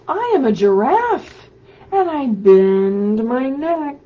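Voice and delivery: loud, lively picture-book read-aloud; animated storybook voice calling out to children. Acted. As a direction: read it big for little kids at home. Reads picture book aloud to kids.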